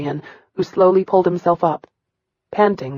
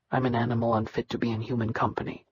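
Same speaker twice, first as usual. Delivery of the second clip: fast, much softer rote recital; empty recitation; 7 semitones lower, 12 dB quieter, faster than usual. Acted. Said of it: "the emptiness in his tone".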